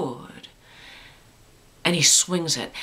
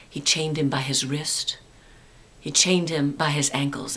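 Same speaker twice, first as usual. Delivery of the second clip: low hushed ghost-story voice; spooky ghost tour hush; 4 semitones lower, 3 dB quieter than usual. A narrator's reading. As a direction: tell it dark and soft.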